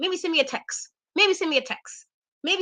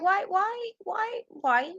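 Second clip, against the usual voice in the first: high, rising string of whys; exasperated questioning; 7 semitones higher, 5 dB quieter, much slower than usual. Retold or imitated. Host reacting to Jinder's sudden challenge, exasperated questioning.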